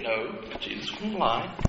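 usual speaking voice

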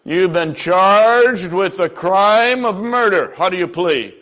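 slow, measured judge voice. Acted. Preacher voicing a judge charging a murderer, slow and stern.

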